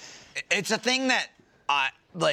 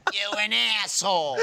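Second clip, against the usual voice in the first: mock indignation; 5 semitones higher than usual. Imitating her snapping at him, mock indignation. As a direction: throw it out in mock outrage. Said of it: "She goes".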